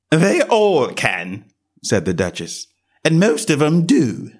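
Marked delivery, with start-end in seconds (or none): 0.1-1.5 higher, slow, haughty lady's voice; a grand lady dismissing a question; curt Duchess. A narrator's reading. Duchess answers. 3.0-4.3 a grand lady dismissing a question; curt Duchess. A narrator's reading. Duchess answers.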